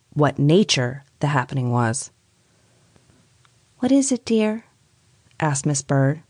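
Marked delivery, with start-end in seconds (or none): 3.8-4.6 high, tender but tense; strained loving voice. A narrator's reading. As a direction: comfort her while worried. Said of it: "loving voice had a strained sound".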